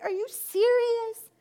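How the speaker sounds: high, slow disbelieving question; can't believe what you're hearing; incredulous. Retold or imitated. Woman told to eat only fruit and vegetables, incredulous.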